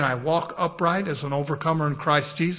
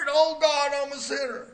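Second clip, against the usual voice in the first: mock scared prayer; 8 semitones higher, 3 dB louder than usual. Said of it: "And they're scared".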